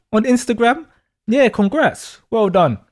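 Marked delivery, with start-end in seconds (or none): none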